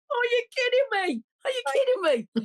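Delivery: reliving the tears of hearing they'd won; retold crying disbelief. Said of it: "And I was going, crying".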